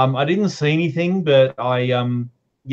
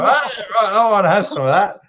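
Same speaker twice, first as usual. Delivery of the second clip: slightly hammed-up, higher-pitched customer; mock keen customer; 7 semitones higher than usual. Joker playing someone ordering a fried mop head, mock keen. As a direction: play an eager order, voice lifted.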